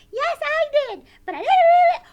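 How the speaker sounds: very high, loud puppet squeal; frantic squeaky puppet voice; wound-up puppet Sally. Pretend. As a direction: shriek it as a hyper puppet.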